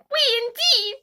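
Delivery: angry mouse crying out at Alice; furious mouse. A narrator's reading. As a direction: shriek back in disgust. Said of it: "cried the mouse".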